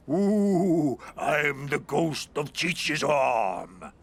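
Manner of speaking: spooky ghost voice. Pretend.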